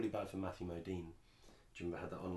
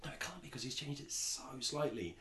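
high, disbelieving retold wondering; retold puzzlement, pitch raised; puzzled self-questioning; 7 semitones higher than usual. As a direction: voice your own baffled double-take, pitched up. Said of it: "I was watching it going".

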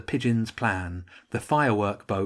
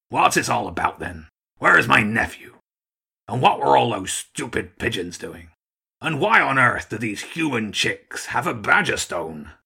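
put-on loud, demanding old gull; blustering old Archduke; 4 dB louder than usual. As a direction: demand answers like an irritated commander.

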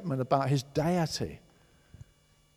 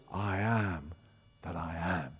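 voice of God; 10 semitones lower, 9 dB quieter than usual. Acted. God answering Moses from the bush, low and solemn.

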